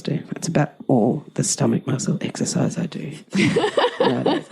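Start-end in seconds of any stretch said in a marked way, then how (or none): 3.2-3.9 high giggle. Real, not acted. Laughing at her own joke.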